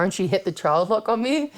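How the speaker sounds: usual speaking voice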